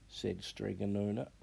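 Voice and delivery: ordinary delivery, nothing marked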